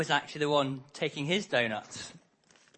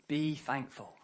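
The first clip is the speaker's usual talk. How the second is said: "Be thankful" repeated softer, falling; soft echo; 4 semitones lower, 8 dB quieter, slower than usual. Shown as a demonstration.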